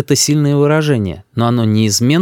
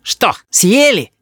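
put-on high, slow gloating kid; high-pitched bratty jeer; child's taunt; 10 semitones higher, much slower than usual. A narrator's reading. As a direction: taunt the parents triumphantly. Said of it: "корчит нам рожей Рис".

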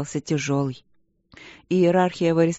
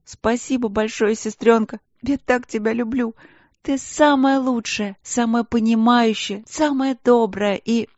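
gushing; 6 semitones higher, 3 dB louder than usual. Acted. Crying sister gushing thanks.

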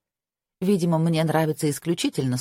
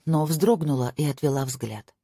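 ordinary delivery

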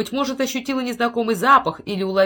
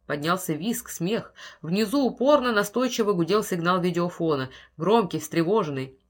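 usual speaking voice

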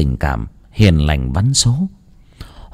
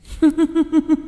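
high, shrill-free ghostly laugh; eerie ghost cackle; 4 dB quieter than usual. A narrator's reading. Possessed man laughs at the priest, ghostly cackle. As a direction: cackle like a spirit. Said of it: "mà cười giặt giẽo".

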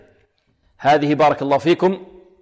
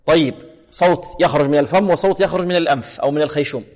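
ordinary talk, nothing marked

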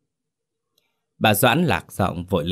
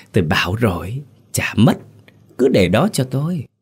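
louder, higher knowing wife; scheming wife, smug; sly reassurance; 4 semitones higher, 5 dB louder than usual. Acted. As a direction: brush his worry aside slyly. Wife overrules husband, sly.

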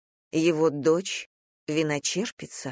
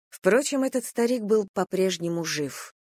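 plain reading voice

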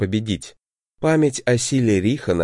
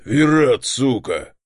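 roaring bear; 2 semitones higher, 3 dB louder, slower than usual. A narrator's reading. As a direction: bellow his name in rage. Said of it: "проревел он так, что, казалось, потолок обвалится".

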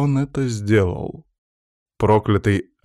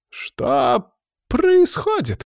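very high, drawn-out wail; panicked cry; 3 dB louder, much slower than usual. A narrator's reading. Trapped cursed man behind a magic dome, panicked cry. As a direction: cry out slowly, high and bewildered. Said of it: "кричал на фоне мужчина в сером".

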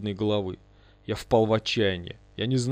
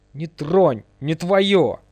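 loud, high warning shout; possessive shout; 5 semitones higher, 7 dB louder, much slower than usual. A narrator's reading. Ghost pushes Лиза from his chest, possessive shout. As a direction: warn them off, louder and higher.

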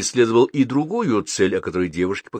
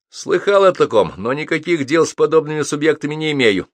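high, louder disdainful declaration; raised voice full of distaste; hostile distaste; 7 semitones higher, 4 dB louder than usual. A narrator's reading. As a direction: distance yourself with open dislike. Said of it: "неприязненно заявил он".

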